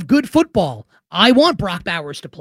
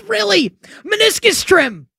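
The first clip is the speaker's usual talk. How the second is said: high-pitched, disbelieving reaction; high and drawn out, not buying it; mock incredulity; 11 semitones higher than usual. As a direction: squeak it in exaggerated disbelief. Acts out own incredulous reaction to clip.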